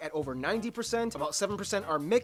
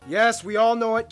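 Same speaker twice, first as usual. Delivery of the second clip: louder, knowing; 8 dB louder than usual. Real, not acted. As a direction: say it loudly, in on the joke. Loud knowing follow-up.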